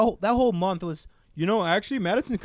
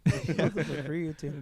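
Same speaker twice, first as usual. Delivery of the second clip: laughing; 4 semitones lower, 3 dB quieter, slower than usual. Real, not acted. Echoing a joke, laughing.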